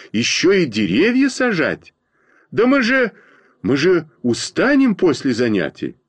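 high-pitched outcry, moderately loud; yelled protest. A narrator's reading. Schoolboys object to planting trees after lessons, crying out. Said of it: "заорали мы с Костей в один голос".

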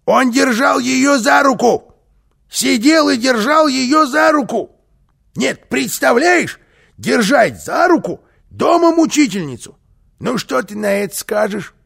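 high-pitched, indignant outburst; irritated outrage. A narrator's reading. Карлсон complains to boy about hand-holding, indignant high voice. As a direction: squawk with scandalised irritation. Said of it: "Карлсон раздражённо покачал головой".

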